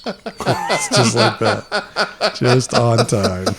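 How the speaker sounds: chuckling through slow words; laughing. Real, not acted. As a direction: laugh while you talk it out. Cracking up at a joke.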